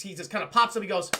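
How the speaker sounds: usual speaking voice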